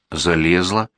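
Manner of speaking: ordinary delivery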